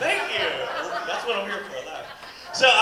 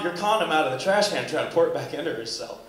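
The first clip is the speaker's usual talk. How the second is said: ordinary talk, nothing marked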